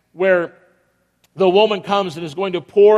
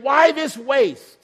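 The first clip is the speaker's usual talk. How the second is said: slow, higher indignant why this waste; indignant disciples; 6 semitones higher, much slower than usual. Acted. Disciples scolding the woman, slow indignant scorn. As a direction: stretch it in scornful disbelief. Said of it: "But they said".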